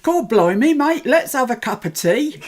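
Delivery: mock Cockney cheer, overdone; exaggerated Cockney. S1 wants a British accent, so hammed-up Cockney. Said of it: "very exaggerated".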